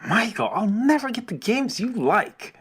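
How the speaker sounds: a playfully harsh pretend message; mock blunt email voice. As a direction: say it as if bluntly insulting. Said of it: "What if I said".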